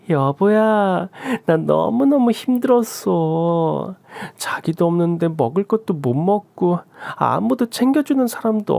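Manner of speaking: cling and whine for sympathy; whiny young wife. Acted.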